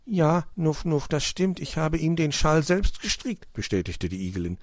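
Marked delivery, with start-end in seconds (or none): 0.1-3.5 high-pitched motherly storybook voice; hedgehog mother, confirming. A narrator's reading. Mother recognising the scarf she knitted, assured.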